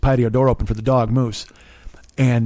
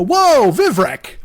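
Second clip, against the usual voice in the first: drawn-out, high-pitched whoa; acting out a startled passenger; alarmed protest; 9 semitones higher, 3 dB louder, much slower than usual. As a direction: call out in mock alarm, high and slow. Startled passenger as driver crosses line.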